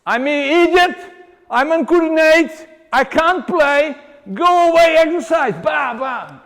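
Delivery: high, loud whiny self-mockery; mocking traumatized client. Trainer mimics a hurt client whining loudly. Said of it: "you know".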